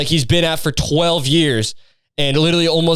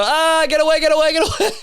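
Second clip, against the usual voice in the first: high, whiny get away, get away; mock panicked squeal. Shooing away any girl who comes near, high panicked squeal. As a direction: shriek it like a panicked child. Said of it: "just go".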